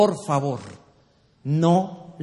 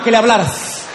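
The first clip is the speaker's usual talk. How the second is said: rushed nagging wife; 2 semitones higher, much faster than usual. Retold or imitated. Wife bursts out at her husband at the door, rapid and rattled. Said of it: "Sale la mujer, pero volada".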